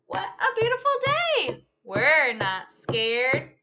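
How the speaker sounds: lively, sing-song storytime read; animated picture-book telling; reading to children. Acted. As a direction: act out the story for a class of kids. Teacher retells picture book with moves.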